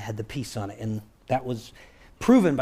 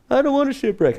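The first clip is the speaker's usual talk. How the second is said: high-pitched, louder wail of refusal; hammed-up high-voiced objection; mock protest; 11 semitones higher, 5 dB louder, faster than usual. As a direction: protest like a reluctant shipmate. Acts out protest at coming shipwreck.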